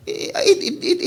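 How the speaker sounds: usual speaking voice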